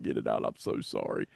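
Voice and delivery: breathy whispered sorry, almost no voice; host whispers an apology to his co-host. Real, not acted. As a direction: whisper it under your breath.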